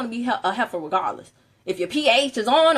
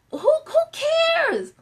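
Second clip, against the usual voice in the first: drawn-out who cares; much slower than usual. Real, not acted. Shrugging off complainers.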